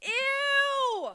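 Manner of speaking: high, drawn-out disgusted yell; imitated yell. Party guest pointing at the floor, imitated yell of disgust. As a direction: mimic a girl yelling in horror. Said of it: "and she yells".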